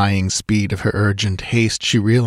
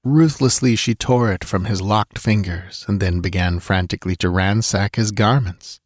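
ordinary delivery

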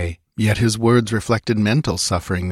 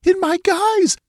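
anguished outrage; 4 dB louder than usual. Acted. Betrayed queen in anguish.

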